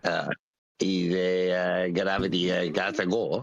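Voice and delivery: slurred, lazy foreign waiter voice; drunk Spanish waiter. Shown as a demonstration. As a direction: redo the sloppy drunk waiter. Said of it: "can you do that again".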